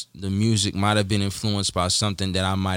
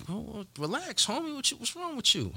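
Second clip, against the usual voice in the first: mock calming voice; 12 semitones higher, 9 dB quieter than usual. Retold guy mocking him.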